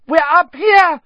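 sudden very high, very loud cry; screamed. A narrator's reading. Hermione calls to footsteps downstairs, screaming. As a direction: scream for help at full pitch. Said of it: "Hermione screamed suddenly".

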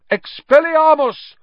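very high, loud spell cry; shouted. A narrator's reading. Lupin disarms Harry bursting in, shouting the spell. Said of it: "Lupin shouted".

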